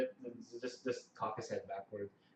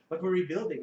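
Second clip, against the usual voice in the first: higher, louder protest; taken aback; 6 semitones higher, 8 dB louder than usual. Acted. Karstein told to return to camp, taken aback. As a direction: object in startled disbelief. Karstein reacts to being called away.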